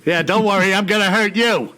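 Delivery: punchy, playful tough-guy comeback; mock threat. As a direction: throw the threat back, hammed-up. Mock threat answering the creepy children.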